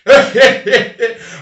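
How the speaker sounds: loud, high cackle at his own joke; burst of laughter. Real, not acted. Cracking up at his own story for the audience, loud laugh. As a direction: break up laughing.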